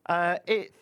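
bright high ad voice; hammy radio ad pitch; ad read. Acted.

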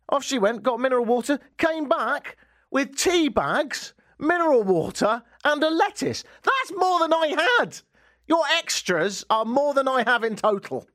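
high-pitched, mounting indignant rant; aggrieved storytelling, voice pitched right up; comic outrage. Retold or imitated. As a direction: list the items in rising disbelief. Rant about the shopper's extras.

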